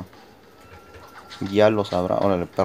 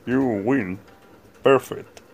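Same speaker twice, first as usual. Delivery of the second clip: lightly voiced arcade announcer; fighting game announcer; 3 semitones higher, much slower than usual. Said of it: "Como decía el de Street Fighter".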